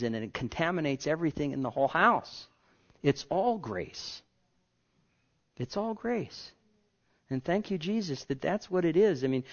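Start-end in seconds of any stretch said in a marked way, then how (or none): 3.0-4.2 slow, deliberate refrain; drawn-out all grace. Real, not acted. Slowed repeated refrain. 5.6-6.5 softer, higher echo of the refrain; a quiet second all grace; 1 hushed repeat. Real, not acted.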